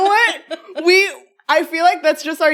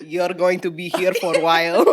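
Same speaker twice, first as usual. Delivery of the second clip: put-on deep, level Russian accent; low mock Russian movie jailer; Russian prison guard; 11 semitones lower than usual. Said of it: "and she's Russian".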